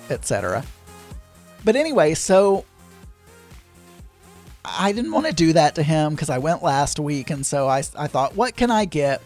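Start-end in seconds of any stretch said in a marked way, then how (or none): none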